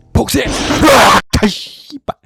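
high, loud terrified scream; panicking gamer. Real, not acted. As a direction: shriek at the jump scare. Foxy jump scare.

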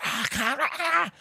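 squeaky yelp of mock distress; retold panicked scream. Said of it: "screaming at me".

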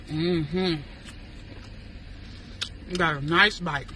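talking while chewing food; speaking between spoonfuls; mouth full, chewing. Real, not acted.

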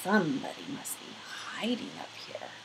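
soft, falling to a hushed whisper; suspicious whisper. Acted. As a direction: whisper as if the intruder might hear. Watchman suspects a hidden intruder.

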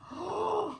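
high delighted gasp; sharp gasp. Real, not acted. Vlogger spotting a lovely card, delighted gasp. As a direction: gasp in surprise.

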